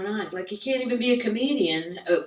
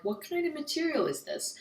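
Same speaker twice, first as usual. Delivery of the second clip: a higher, puzzled customer question; higher mock inquiry; 8 semitones higher, 3 dB quieter than usual. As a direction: ask it in a raised, curious voice. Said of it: "I'm like".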